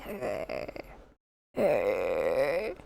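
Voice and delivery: low creaky zombie groans; soothing zombie voice. Pretend. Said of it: "soothing zombie voice to help you sleep".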